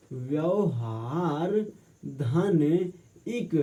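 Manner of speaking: very slow, splitting व्यवहार and इक apart; slow breakdown. Shown as a demonstration. Teacher to students, slowly breaking the word apart. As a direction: spell out each part slowly for the class. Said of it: "इसके संधि विच्छेद क्या होगा".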